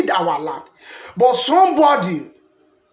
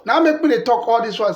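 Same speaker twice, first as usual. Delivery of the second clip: usual speaking voice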